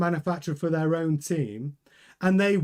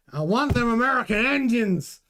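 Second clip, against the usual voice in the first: put-on terrible Texan accent; 5 semitones higher than usual. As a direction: play a Cadillac boss with a bad Texan accent. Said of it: "Terrible accent again".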